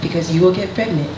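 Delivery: put-on deliberate Haitian-accented mother, long pauses. Mother warning her daughter about boys, stern Haitian accent. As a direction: lay down rules in a Haitian accent, pausing between each. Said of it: "I'm gonna do the accent".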